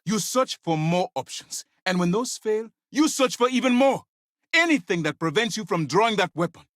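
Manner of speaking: angry reprimand. Acted. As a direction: lay into them, climbing as you go. Commander berates agent for using violence.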